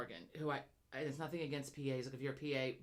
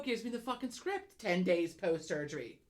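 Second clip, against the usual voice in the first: ordinary delivery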